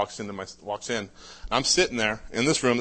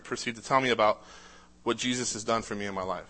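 normal talking voice

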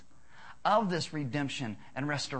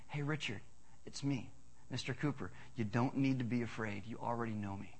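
much softer, lower reassuring voice; gentle reassurance; 6 semitones lower, 10 dB quieter than usual. Retold or imitated. Kind neighbor to scared child, soft reassurance. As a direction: soothe the frightened boy gently. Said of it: "pulls back his beard and he goes".

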